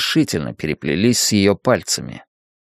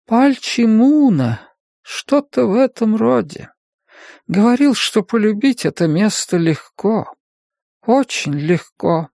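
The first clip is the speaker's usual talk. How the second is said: dreamy sister voice; 10 semitones higher than usual. A narrator's reading. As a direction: speak from far away. Sleepy sister answers.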